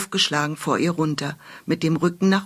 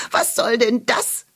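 squeaky hedgehog; 4 semitones higher than usual. Acted. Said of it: "quietschte ein Igel ärgerlich".

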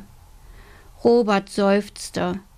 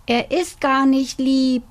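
complaining; 5 semitones higher, 3 dB louder than usual. Acted. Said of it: "klagte Robert".

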